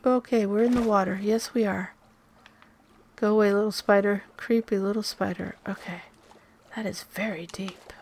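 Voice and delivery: ordinary delivery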